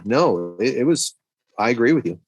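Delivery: ordinary talk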